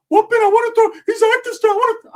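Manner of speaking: high squeaky voice for the cousin; squeaky high-voiced cousin calling. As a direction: pitch way up as the cousin. Cousin butting in on the call.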